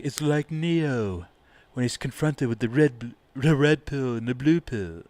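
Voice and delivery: quoting David Icke in a put-on British accent. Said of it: "I can't do a British accent".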